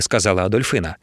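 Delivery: ordinary delivery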